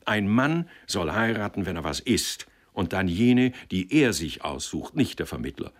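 usual speaking voice

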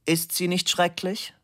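an unseen husband's dry question; stranger's voice. Acted. Husband in doorway asking Fabian about his wife, strange voice. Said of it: "fragte da eine fremde Stimme".

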